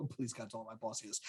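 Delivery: very soft, quick aside; hushed joking prayer. Pretend. Worker hoping the boss won't hear, hushed mock plea. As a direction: drop the voice right down, as if not to be overheard.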